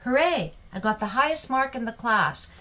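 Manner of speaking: high, cheering "Hooray" and proud news; excited exclamation. Shown as a demonstration. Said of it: "the excitement is here after hooray".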